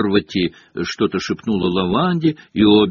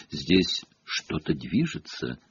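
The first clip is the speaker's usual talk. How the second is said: slow, hushed seer's murmur; whispered; 4 semitones lower, 7 dB quieter, slower than usual. A narrator's reading. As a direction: murmur it low and slowly over the crystal ball. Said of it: "прошептала профессор". (медленно, таинственным полушёпотом)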